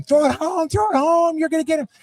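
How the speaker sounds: shrill over-the-top sports parent shouting from the stands; mock yelling parent. Parent yells from sideline at child, mock yell. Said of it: "Instead of yelling".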